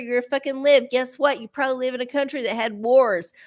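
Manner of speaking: ordinary talk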